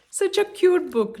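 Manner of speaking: delighted. Real, not acted. Reading to a child, delighted.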